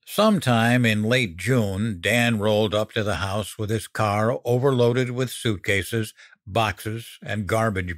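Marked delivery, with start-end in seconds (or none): none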